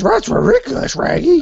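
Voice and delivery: very high cartoon dog voice; Scooby-Doo impression. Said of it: "He says".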